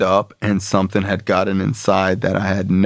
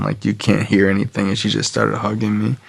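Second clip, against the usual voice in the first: ordinary talk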